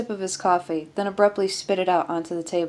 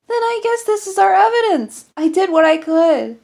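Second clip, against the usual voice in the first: much higher and louder, wound-up protest; upset defensive outburst; 13 semitones higher, 10 dB louder than usual. Acted. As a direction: defend yourself at full pitch. Defensive reply in medical emergency.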